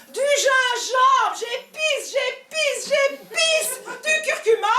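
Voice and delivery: high, slow, rising ecstatic cries; ecstatic squeal. Acted. Cook spicing her lover, squealing in ecstasy. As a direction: squeal each spice in mock rapture.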